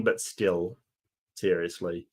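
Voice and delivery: usual speaking voice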